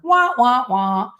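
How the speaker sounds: high, loud mock baby crying; mock wah wah. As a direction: mimic a whiny baby wailing. Mocking crying.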